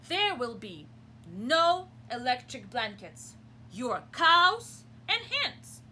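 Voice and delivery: loud, slow, stern dictation; stern farmer's letter. Acted. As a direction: read it like a firm demand. Farmer's letter read aloud.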